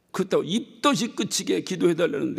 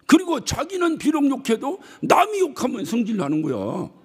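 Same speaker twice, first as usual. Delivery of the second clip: ordinary talk, nothing marked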